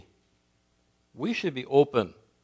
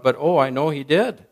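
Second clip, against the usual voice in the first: put-on a little girl crying out, louder and eager; cried out; 4 semitones higher, 10 dB louder than usual. Little girl below the pulpit, calling up to the preacher, crying out. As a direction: blurt it out like a child who forgets she's in church. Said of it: "cried out".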